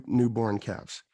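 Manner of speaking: ordinary talk, nothing marked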